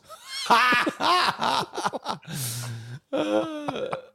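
long high cackle, dying away; real helpless laughing fit. Real, not acted. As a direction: crack up hard at your own pun. Cracks up at own pun.

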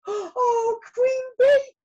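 high, loud wail; hammed-up squeal of dismay; distressed cry. A narrator's reading. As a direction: cry out the queen's title in alarm. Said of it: "Lizzie cried".

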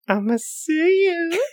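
high, drawn-out gloating threat; crowing sing-song mock menace; Michael Darby impression. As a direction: taunt slowly in a squeezed high pitch. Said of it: "I love this Michael Darby impression".

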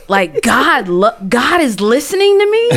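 high, laughing, amazed question; can't believe it, laughing through the words; laughing disbelief. Real, not acted. Telling friends God heard her, laughing in joyful disbelief.

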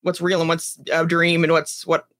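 ordinary delivery

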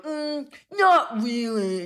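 high, drawn-out snobby doubt; mock a picky accent snob. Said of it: "accent snobs that are like".

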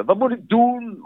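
high, panicky mock plea. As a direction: imitate a desperate client. Panicking clients quoted. (paniekerige smeekbede)

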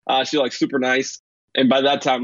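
ordinary talk, nothing marked

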